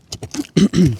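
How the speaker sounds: clearing a tired throat; a quick rough throat clear. Real, not acted. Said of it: "Excuse me".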